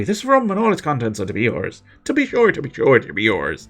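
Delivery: put-on lilting Irish accent. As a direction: play it broad Irish. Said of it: "can I ask about your accent".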